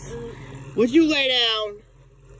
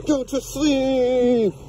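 slow sleepy order; 2 semitones higher, much slower than usual. Real, not acted. Owner to dogs at bedtime, slow coaxing. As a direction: coax them toward sleep.

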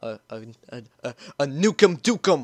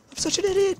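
much higher frantic self-blame; panicked self-scolding; faster than usual. Real, not acted. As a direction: berate yourself in panic. Self-scolding after blunder.

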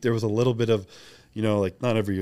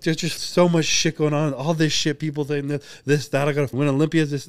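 retold tearful outburst; 6 semitones higher, 3 dB louder than usual. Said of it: "And then I start bawling".

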